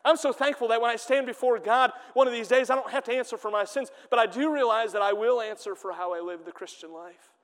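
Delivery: normal talking voice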